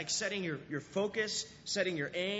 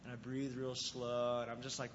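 calm and chilled; 5 semitones lower, 4 dB quieter than usual. Retold or imitated. Pastor describing floating calmly before his dive, relaxed. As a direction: ease the voice right down like floating. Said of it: "So then I chill out".

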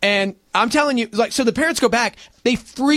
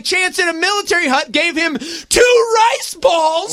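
insistent shout; 8 semitones higher than usual. Real, not acted. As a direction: shout the point over them. Insisting loudly over laughter.

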